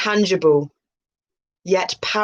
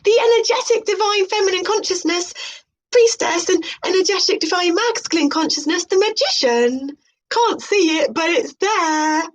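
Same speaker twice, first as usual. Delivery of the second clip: high, airy parody of a mystic presenter; mock spiritual host; 12 semitones higher than usual. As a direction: announce it like a dreamy new-age show host. High mocking aside on tarot.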